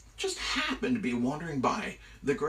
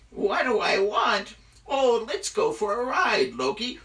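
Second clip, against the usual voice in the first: loud, high, gleeful mock echo; taunting eagle; 9 semitones higher, 7 dB louder than usual. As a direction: toy with the captive, drawing it out. Eagle taunts his captive.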